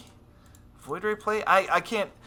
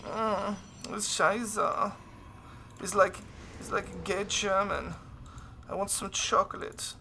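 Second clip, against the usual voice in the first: put-on soft, higher-pitched German accent; sexy German accent; 6 semitones higher, 7 dB quieter than usual. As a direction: play a mock-sultry German, softly. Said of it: "my German accent is always kind of sexy".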